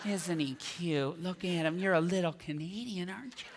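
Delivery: put-on baby-talk an adorable Canadian; cooing. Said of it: "I expect to hear more like".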